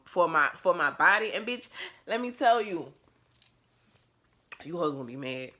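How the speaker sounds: usual speaking voice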